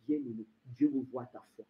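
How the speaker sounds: very low, soft address; hushed. Acted. Preacher reassuring the beloved about faith, hushed. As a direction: drop to a confiding near-murmur.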